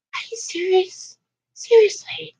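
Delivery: high, soft disbelieving squeak; incredulous. Retold or imitated. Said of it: "and you're like".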